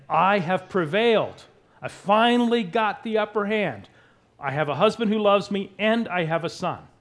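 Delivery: loud, high, emphatic declaration; triumphant boast. Acted. Wife boasting she got the upper hand, triumphant. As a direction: crow over a won rivalry.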